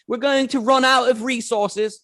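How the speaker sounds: high, exaggerated foreign accent; mock British-ish accent. Imitating British panelists in a mock accent. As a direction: put on a vague Australian or South African lilt. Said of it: "Sorry for the accent".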